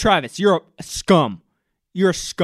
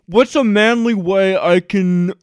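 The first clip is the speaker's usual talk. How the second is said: hammed-up dudebro voice, louder; mock Clay Travis; 2 semitones higher, 8 dB louder than usual. Mocking Clay Travis's inner voice, loud dudebro. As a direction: play the macho troll figuring out his stunt. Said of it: "he's like".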